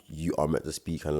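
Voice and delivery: usual speaking voice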